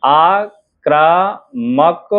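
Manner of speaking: very slow आक्रामक, split आ... क्रा... मक; drawn-out dictation of आक्रामक with gaps; syllable by syllable. Shown as a demonstration. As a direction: sound out आक्रामक piece by piece. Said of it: "देखिएगा".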